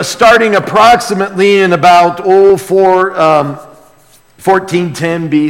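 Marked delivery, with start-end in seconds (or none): none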